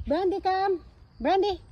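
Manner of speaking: high singsong call to a pet; calling the dog. Real, not acted. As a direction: call your dog over, pitched high. Calling her dog to come.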